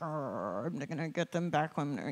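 low, soft growly grumble; mock vengeful grumble. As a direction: grumble it under your breath, plotting revenge. Acting out someone stewing over revenge.

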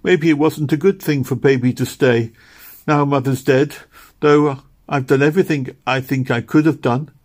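put-on a girl begging God to spare her brother; earnest weeping prayer; desperate prayer. A narrator's reading. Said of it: "she cried".

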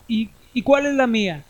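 high, loud stammer of fear. Acted. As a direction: stumble over it, terrified. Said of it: "preguntó temeroso".

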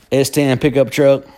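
ordinary talk, nothing marked

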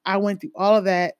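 usual speaking voice

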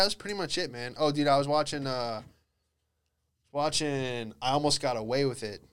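ordinary talk

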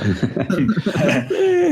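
real burst of laughter; hearty laugh. Real, not acted. Laughs at joke about ending.

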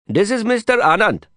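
high, quick Indian guide voice; eager street guide. A narrator's reading. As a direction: jump in quickly and brightly. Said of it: "Prabhakar interjected quickly".

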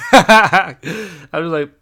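high laughing through the words. Real, not acted. Recounting the fight to viewers, laughing. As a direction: laugh while saying it.

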